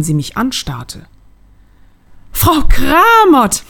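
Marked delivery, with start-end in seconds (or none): 2.3-3.6 hoch, laut und gedehnt aufgeschrien; erschrockener Ausruf. Acted. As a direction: vor Schreck herausgeplatzt. Inhaberin erschrickt beim Anblick. (die Inhaberin entdeckt die Kundin, entsetzt aufschreiend)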